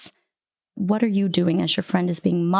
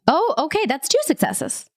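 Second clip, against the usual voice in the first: surprised; 8 semitones higher than usual. Real, not acted. GM realises roll result.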